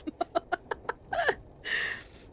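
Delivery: high, breaking-up laugh. Real, not acted. Reader amused by the children preferring their dad, laughing.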